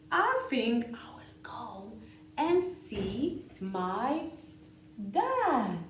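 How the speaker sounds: lively, sing-song picture-book read-aloud with whispered bits; reading to children. A narrator's reading.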